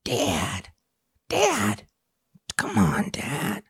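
put-on high, soft whiny kid calling Dad; pleading cartoon kid voice; Bobby Hill impression. Friend asked for Bobby Hill, soft whining to Dad. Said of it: "Can you do, like, Bobby Hill".

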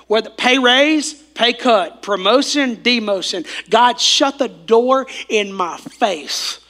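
loud, slow, building preacher cry; preaching at full force. Real, not acted. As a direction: lambungkan seruan khotbah dengan lantang. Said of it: "preaching at full force".

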